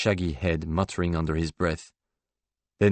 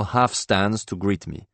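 usual speaking voice

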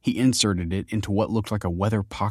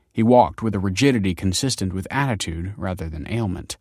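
plain reading voice

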